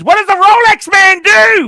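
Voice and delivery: sudden high, loud shout; big shout. Real, not acted. Host shouting his question at the guest, loud. Host demands inspiring words.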